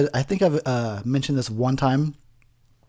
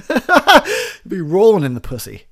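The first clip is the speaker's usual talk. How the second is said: laughing; 6 semitones higher, 8 dB louder than usual. Real, not acted. Joking with laughter.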